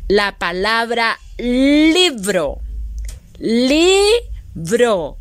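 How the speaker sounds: libro said slowly as li-bro; syllable demo. Shown as a demonstration. Teacher showing libro beat by beat. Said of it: "Inicia con la letra L".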